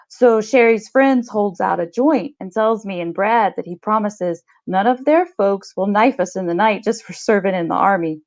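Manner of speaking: normal talking voice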